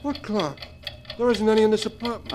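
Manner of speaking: insist, frightened, there's no clock; nervous denial. Acted. Joe nervously tells Duke there is no clock, high and anxious.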